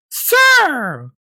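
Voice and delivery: imitated high, drawn-out yell; high-pitched mock shout. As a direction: mimic an outraged lady calling through a car window. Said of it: "yelling at me".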